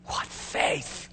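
hushed, breath-only exclamation; soft, voiceless amazement; awed whisper. Real, not acted. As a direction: whisper it in wonder. Marvels at the martyr's faith.

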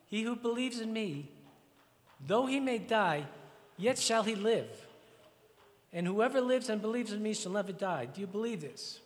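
full pulpit voice declaring the resurrection; preaching at full force. Acted. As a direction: proclaim it so the whole room hears. Reads scripture then preaches.

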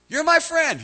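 high, loud declaration; voice of God. Acted. God assuring a doubter, emphatic declaration.

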